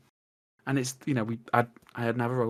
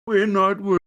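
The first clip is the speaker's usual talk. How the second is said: loud, high breezy mock reassurance; mock unbothered; 6 semitones higher, 7 dB louder than usual. Quoting a co-host brushing off worry, loud breezy mock voice. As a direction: toss it off like nothing matters. Quoting Wayne dismissing worries.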